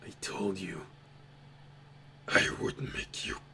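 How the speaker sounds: sinister whisper echoing from the dark; echoing menacing whisper. Acted. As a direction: breathe the threat like a ghost in the background. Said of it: "you hear it in the background echoing".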